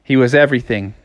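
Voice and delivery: usual speaking voice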